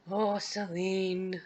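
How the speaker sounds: very slow, soft, creepy croon; stalker voice. Acted. Stalker to Celine on their first date, slow soft croon. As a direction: croon the name slowly like a stalker. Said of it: "like, stalker voice".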